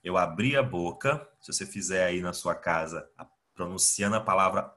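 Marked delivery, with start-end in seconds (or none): none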